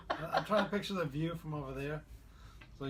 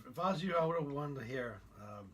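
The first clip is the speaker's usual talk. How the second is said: normal talking voice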